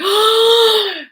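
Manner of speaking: a sharp loud gasp of shock. Retold or imitated. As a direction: suck in air as it hits you. Realizing the bag is gone.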